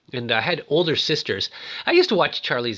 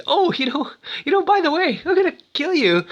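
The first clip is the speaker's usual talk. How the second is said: high, louder mock-casual threat; sneaky doctor; 13 semitones higher, 4 dB louder than usual. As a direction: announce the killing as if it's small talk. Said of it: "the Doc kind of explains".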